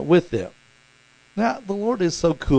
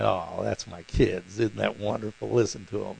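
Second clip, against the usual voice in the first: tender delight; 6 semitones lower, 4 dB quieter than usual. Pretend. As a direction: savour each word like a beaming parent. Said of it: "the Lord's always just right there, just eating it up, you know, saying".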